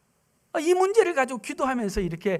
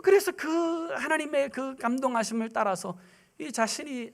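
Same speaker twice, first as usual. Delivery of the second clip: usual speaking voice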